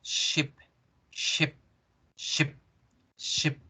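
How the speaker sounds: "ship" with a short lax i, no smile; softly clipped "ship", vowel leaning toward eh; short English I. Shown as a demonstration. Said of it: "Sin sonreír, no decimos chip".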